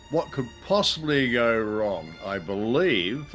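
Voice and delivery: normal talking voice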